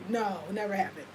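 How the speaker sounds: usual speaking voice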